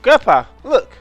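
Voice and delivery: sharp alarmed shout up high; urgent high call. Acted. Character calls out alarm.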